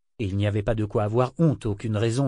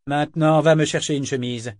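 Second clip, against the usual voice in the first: louder order, snapped at a servant; loud command; 4 semitones higher, 6 dB louder than usual. Acted. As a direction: order him like a lackey. Kénit orders Yémin about. (Kénit ordonne à Yémin, ton fort et impérieux)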